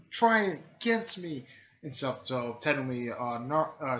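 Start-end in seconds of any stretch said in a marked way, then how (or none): 0.1-1.4 raised-pitch fighting challenge; acted challenge. Fighter daring the sound ninja, acted challenge. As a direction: throw out the dare high, imitating a fighter.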